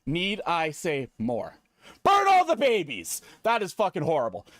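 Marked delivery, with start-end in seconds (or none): 2.0-3.2 high, loud gleeful villain yell; gleeful shout. Acted. [name]'s villain line shouted with glee. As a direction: shriek it like a delighted maniac.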